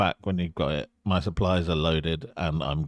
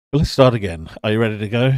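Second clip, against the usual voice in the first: firm restart; 3 semitones higher, 8 dB louder, faster than usual. Acted. Captain repeats line, louder.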